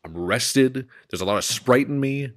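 ordinary talk, nothing marked

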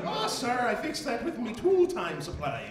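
hammed-up pirate brogue; Irish Tim Allen. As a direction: answer like a comic pirate deckhand. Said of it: "He's an Irish pirate".

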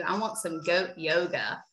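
ordinary talk, nothing marked

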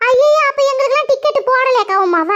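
high, startled friend's cry; shocked ayyo. Acted. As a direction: gasp it out in dismay.

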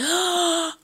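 sharp high gasp of surprise; delighted gasp. Real, not acted. Vlogger seeing the gift inside, sharp delighted gasp. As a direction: suck in air, amazed.

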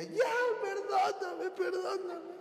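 high, slow mock plea; wife begging after he yells, high and slow; pleading wife. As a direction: beg forgiveness in a high whine. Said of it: "y ella".

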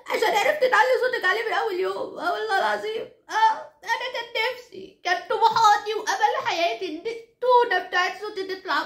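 high tearful lament, half laughing; hammed-up sobbing complaint; mock wailing. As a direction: wail in comic envy and self-pity. Comic wail of envy at bride.

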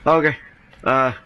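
ordinary talk

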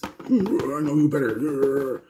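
high, drawn-out hammed-up versus voice; mock showdown voice. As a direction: stretch it out in a loud, high put-on voice. Mock showdown after announcing a versus.

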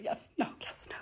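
hushed and unsure, half to herself; whispered indecision. Real, not acted. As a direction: whisper it like you can't decide. Torn over whether to skip.

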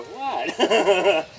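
loud, high, laughing innocent "What?". Shown as a demonstration. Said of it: "then you smile and go".

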